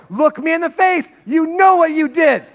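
high, loud mock scolding of the little dog. Pastor scolding his dog Nala over the sandwich, mock stern. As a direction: wag a finger and scold the dog, hammed up. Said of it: "and I go".

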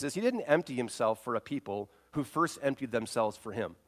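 usual speaking voice

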